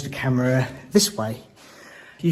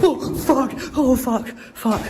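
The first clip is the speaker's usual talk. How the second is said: terrified shout; 10 semitones higher, slower than usual. Real, not acted. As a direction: scream it out in shock. Something moves by itself.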